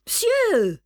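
high, loud indignant cry, falling at the end; irritated exclamation. A narrator's reading. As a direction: cut in with outraged protest. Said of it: "deux voix irritées s'élevèrent".

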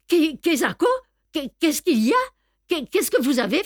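high, stammering surprise; startled questioning. A narrator's reading. Captain coming up from below deck to the commotion, startled. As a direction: stumble into the questions, pitched high.

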